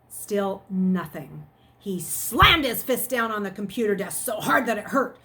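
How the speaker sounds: steady read-aloud storytelling; story narration. A narrator's reading. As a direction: read the chapter to the class. Reads chapter aloud to students.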